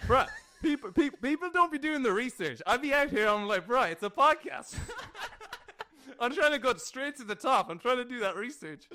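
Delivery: high, soft, laughing brag. Real, not acted. Host laughing to the guest about doing his research. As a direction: giggle through a mock brag.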